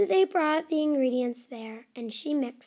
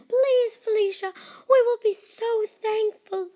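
much higher, louder begging; begging elves; 10 semitones higher, 6 dB louder than usual. Acted. Elves beg Felicia, high and loud pleading.

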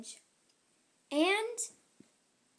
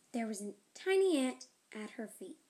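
ordinary delivery

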